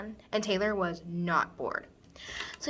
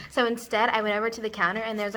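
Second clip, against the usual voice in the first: ordinary delivery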